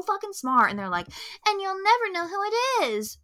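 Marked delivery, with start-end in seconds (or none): 1.5-3.2 mock smug mystery. Host mocks filmmakers who think they're so smart, smug and high. Mocking the film's smug secrecy.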